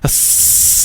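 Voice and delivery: long snake-like hiss; imitated hiss. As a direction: hiss like a kid playing snake. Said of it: "started making a hiss".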